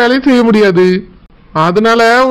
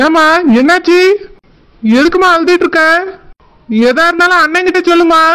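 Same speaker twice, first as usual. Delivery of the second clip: alarmed brother; 5 semitones higher than usual. Acted. Brother hears sister crying on phone.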